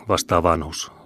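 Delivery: ordinary delivery, nothing marked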